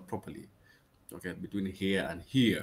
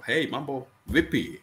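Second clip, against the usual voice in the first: higher-pitched, louder casual hello; hearty greeting; 5 semitones higher, 5 dB louder than usual. Acted. Saying hello to local people, hearty call. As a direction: hail them like an old acquaintance.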